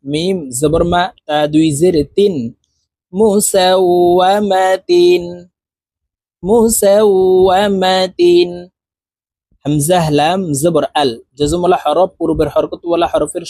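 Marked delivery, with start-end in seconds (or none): 3.1-8.5 drawn-out Quranic recitation, syllable by syllable; slow model recitation. Shown as a demonstration. Teacher reciting the assembled word for learners, slow chant. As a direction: stretch each syllable evenly, half-chanted.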